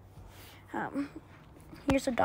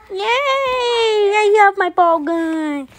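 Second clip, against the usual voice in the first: excited; 9 semitones higher, 3 dB louder, much slower than usual. Acted. Child thrilled with a toy.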